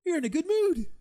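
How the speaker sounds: high, loud forced cheer; fake good mood. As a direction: put on exaggerated happiness. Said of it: "you have to always look like".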